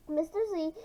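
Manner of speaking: plain reading voice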